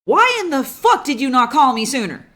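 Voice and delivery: loud, high yell at the player; angry shout. Acted. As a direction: Momi furiously demanding answers. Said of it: "And Momi looks and goes".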